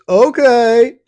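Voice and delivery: hammed-up naive girl saying yes; naive teen girl. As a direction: chirp it like a clueless teen. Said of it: "Eighteen-year-old says".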